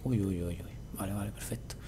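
hushed whisper to self. Real, not acted. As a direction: breathe the words tensely. Tense moment while mining.

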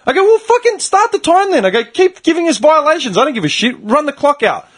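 high-pitched, exasperated challenge; retold defiance. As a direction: re-enact arguing back at the refs. Retold defiant argument with the refs.

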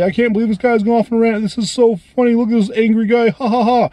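high, teasing voice of a laughing viewer; mocking commenter. Parroting a viewer who laughs at his angry rant, high and mocking. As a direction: sneer it like someone amused by the rant. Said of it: "if you just wanna comment below and say".

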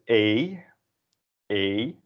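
long "ay" for Dutch E. Shown as a demonstration. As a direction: say the E as a drawn-out "ay". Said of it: "in Dutch, it's pronounced as".

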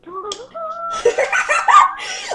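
long high-pitched cackle; high squealed laugh. Pretend. Grand entrance as a queen.

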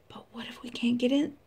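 fast, breathy whisper; hushed nervous chicken; scared whisper. Acted. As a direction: rush it in a frightened whisper. Scared chickens fear the cave.

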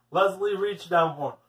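plain reading voice

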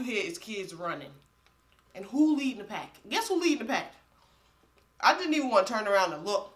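eating off the bone; talking while chewing. Real, not acted. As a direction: mouth full of meat. Seen 1: bites meat at +0.8, chews while talking after.

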